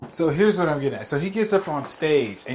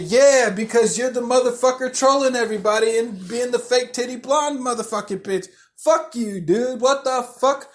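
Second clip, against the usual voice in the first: higher-pitched, heated comeback; retold heckler's outraged rant; indignant retort; 6 semitones higher than usual. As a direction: fire back in angry disbelief. Retold heckling argument with the comic.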